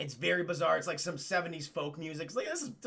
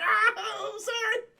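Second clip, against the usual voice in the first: laughing; 5 dB louder, slower than usual. Retold or imitated. Followers laugh at the leader.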